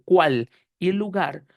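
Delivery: normal talking voice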